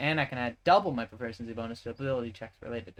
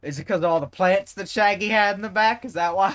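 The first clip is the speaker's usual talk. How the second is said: lightly voiced old man, louder and higher; a hint of a creaky old man teasing; 8 semitones higher, 10 dB louder than usual. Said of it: "I'm still doing my old man voice".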